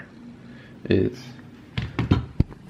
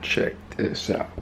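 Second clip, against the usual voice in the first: whisper; 7 dB quieter, slower than usual. Real, not acted.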